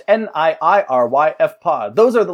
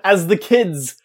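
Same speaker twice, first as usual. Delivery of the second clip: slow, loud, hammed-up prophecy voice; hammed-up epic announcer; mock-epic proclamation; 3 semitones higher, 5 dB louder, slower than usual. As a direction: proclaim it like an ancient prophecy, half laughing.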